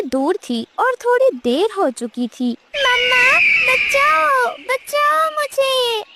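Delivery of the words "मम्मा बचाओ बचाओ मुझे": much higher, slow, screaming for help; a chick snatched, screaming for mother; terrified scream. Acted. Chick screams to mother while the kite grabs it, high terrified cries.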